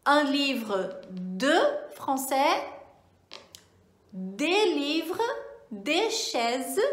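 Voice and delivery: slow careful French review phrases; slow French model sentences; review examples. Shown as a demonstration. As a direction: read the examples out clearly. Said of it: "Vou dar as frases de exemplo".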